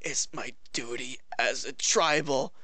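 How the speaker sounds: soft low whispered hero vow; mock hero whisper. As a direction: breathe it like a solemn mock vow. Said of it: "It's like".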